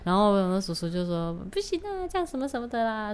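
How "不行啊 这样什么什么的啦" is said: high, soft mock protest; lightly voiced reluctant shopkeeper. Shop uncle refusing mom's haggling, mock reluctance. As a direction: plead a refusal, pitched up and quieter.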